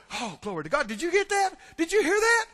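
rising to a high, fast-building pitch; excited. Acted. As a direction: gush like a thrilled churchgoer. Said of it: "People will get excited and go".

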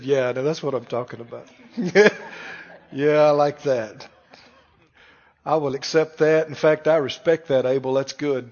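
deep, low, pleased voice of God approving Abel's offering. Acted. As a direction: drop the voice low and sound satisfied. Voicing God approving Abel.

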